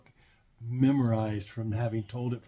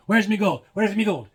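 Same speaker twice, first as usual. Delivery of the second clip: high, loud Irish leprechaun voice; 7 semitones higher, 8 dB louder than usual. Acted. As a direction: play a cranky Irish leprechaun. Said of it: "the line by the leprechaun".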